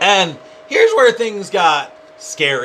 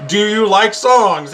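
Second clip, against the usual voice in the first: mock voice assistant; 6 semitones higher, 5 dB louder, slower than usual. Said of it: "So it's like".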